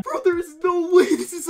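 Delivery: high, loud laughing astonishment; shocked disbelief. Real, not acted. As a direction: react in amused, high-pitched disbelief. Reacting to the voice reveal.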